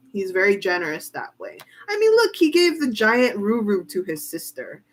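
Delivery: ordinary delivery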